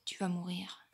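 playful menacing whisper. Pretend. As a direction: whisper the threat like a villain. Threatening an opponent in game.

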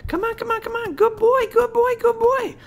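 very high-pitched puppy-calling voice; little high voice. Shown as a demonstration. Trainer calling a puppy to come, little high voice. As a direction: coax the puppy in with a high squeal. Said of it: "get your little high voice".

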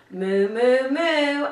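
loud playful mooing like a cow; mock cow moo. To the child, a riddle clue, playful loud cow moo. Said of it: "animal riddles that you might like to do with me".

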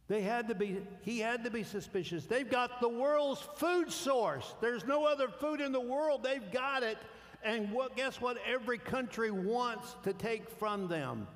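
high, loud preacher's build; full-force preaching. Acted. Pastor presses the congregation, loud and high preaching. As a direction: drive the point home from the pulpit.

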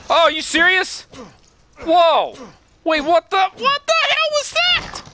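high frightened squeals; panicked yelp. Real, not acted. Scared gamer yelping at sudden noise. Jump scare in horror game.

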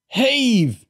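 high, loud lifting call; shouted command. A narrator's reading. Girl directing the stretcher lift, shouting. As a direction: shout the effort out to the team. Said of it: "Lauren shouted".

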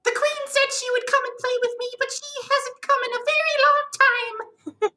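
put-on very high, childlike, eager voice; host voicing Marmu, a lonely caterpillar spirit; sweet Marmu voice. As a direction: sound like a happy puppy who learned to talk. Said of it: "I imagine Marmu's voice being something along the lines of".

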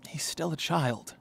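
soft, hushed, measured speech; quiet, grave announcement; mock-solemn gravity. Solemn speech about the child.

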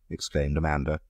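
usual speaking voice

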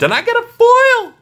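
jumps up high and loud at the foil; thrilled yelp over a lucky pull; real delight bursting out; excited shout. Real, not acted. Pulls a foil card from pack.

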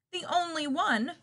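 loud, high, outraged retort; indignant bear growl. Acted. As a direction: scoff at it like a big bear. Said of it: "growled the bear".

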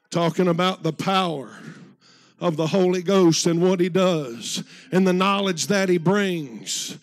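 fervent revival preaching; full preaching. Real, not acted. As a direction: preach it out to the congregation.

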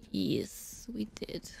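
whisper. Real, not acted. Sneaking past enemies in game.

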